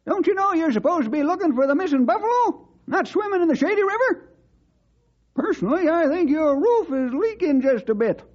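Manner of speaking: put-on high old cowhand voice, teasing disbelief; scolding old sidekick. Sidekick scolding Bill for going swimming, high and mocking. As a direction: chide the young fella like a cranky old-timer.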